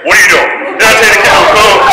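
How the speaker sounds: sudden loud telling-off; angry caught-you shout. Retold or imitated. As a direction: bellow it like an authority catching a culprit. Caught sneaking, retold scolding shout.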